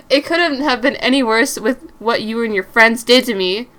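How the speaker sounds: loud, defiant shout; angry shout back. Acted. Mary shouting back at her angry boyfriend. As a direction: throw it back at him.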